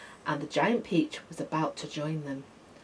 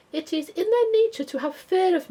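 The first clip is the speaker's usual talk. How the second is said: gentle spider; 13 semitones higher, 7 dB louder than usual. A narrator's reading. Spider explains her nature.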